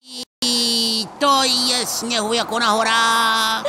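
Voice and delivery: loud happy yell; joyful shout. Acted. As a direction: cheer in the snow. Cheering in the snow. (radostný výkřik)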